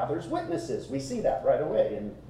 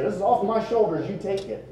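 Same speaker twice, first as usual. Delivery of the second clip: higher, eager acted handoff; enthusiastic; 6 semitones higher than usual. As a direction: gladly pass the burden on to a buddy. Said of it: "I mean, there's enthusiasm here".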